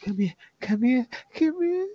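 high, light coaxing character voice; playful high-pitched beckoning. Acted. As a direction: coax them over, pitch climbing with each call. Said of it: "she beckons you in it".

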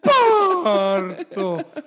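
high, loud, laughing birth scream; mock labor cries. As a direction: play the labor pains hammed-up.

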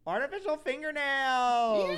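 high, loud and slow exclamation; excited. Real, not acted. Host gleefully announcing the fingernail idea, high and loud. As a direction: relish the words with glee.

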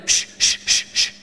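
the crowd silencing the beggar, shushing. Acted. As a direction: hush him up with a hiss. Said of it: "they're like going".

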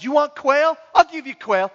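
high, punchy taunting offer; taunting God. Acted. God burying the complainers in quail, taunting. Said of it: "It's kind of this passage".

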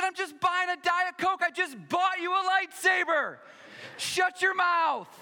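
high, loud exasperated shouting; hammed-up scolding at full volume; mock angry parent. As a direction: yell at your kid in comic outrage. Retells scolding his crying son.

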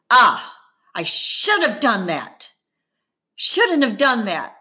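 sheepish groaning admission after punching wall; admitting. A narrator's reading. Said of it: "he admits".

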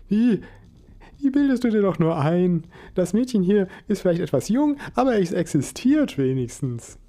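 delighted esoteric mum; cheerful mother. Acted. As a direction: gush over the surprise.

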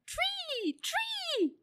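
very high-pitched, loud squeal; crowd cry. A narrator's reading. Dozen animals answer the elephant, high cry.

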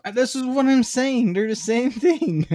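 insistent. Real, not acted. Insisting in the argument.

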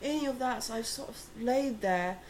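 a hint of a gentle Englishman; British accent. Retelling an old British man describing his flu, light British accent. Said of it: "his little accent".

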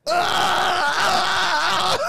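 high piercing mock cry of a woman; imitated loud cry. Friend mimicking the woman's sudden cry at the truck stop, high loud wail. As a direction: let out a shrill fake wail, loud.

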